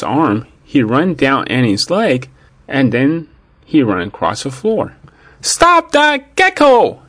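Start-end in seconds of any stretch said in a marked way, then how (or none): 5.4-7.0 high-pitched, drawn-out alarmed yell; high, urgent story-time shout. A narrator's reading. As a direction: yell it high and stretch it out to halt a runaway pet. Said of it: "Annie s-, yelled".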